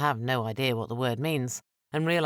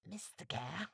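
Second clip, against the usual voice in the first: hushed call of a name, much softer; whispered; 5 semitones higher, 15 dB quieter than usual. A narrator's reading. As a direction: murmur his name so no one else hears. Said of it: "Calico Jack whispered".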